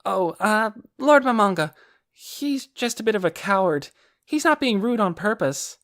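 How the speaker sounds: put-on high, hurried girl voice; young girl voice. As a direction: make excuses like a child. Aura excusing her brother.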